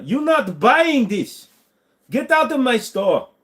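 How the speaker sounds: high, hammed-up Chinese shopkeeper accent; mock Chinese accent. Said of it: "Make a Chinese accent".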